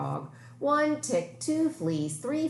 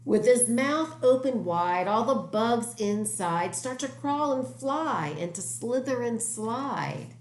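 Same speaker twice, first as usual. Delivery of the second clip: storytelling voice; 7 semitones higher than usual. Acted. Reads story lines with drama.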